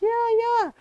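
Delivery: high, loud eager double yeah. Retold or imitated. Boyfriend eagerly egging him on, high and loud. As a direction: cheer him on from behind.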